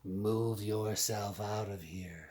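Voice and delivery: lightly voiced menacing snake; a hint of a big snake's threat. A narrator's reading. Big snake ordering the small pig away. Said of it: "says a big snake".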